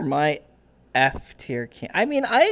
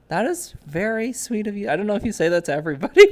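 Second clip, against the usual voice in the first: ordinary talk, nothing marked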